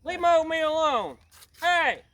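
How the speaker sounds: high, loud outraged yelling over his oatmeal; angry yelling. Acted. Suspect yelling at the cop to leave his oatmeal alone, angry. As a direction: bellow it like he's been robbed.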